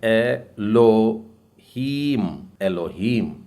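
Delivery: एलोहीम drawn out, syllable by syllable; एलोहीम spelled out slowly; slow model word. Shown as a demonstration. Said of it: "इसके बाद का शब्द है". (एलोहीम धीरे-धीरे)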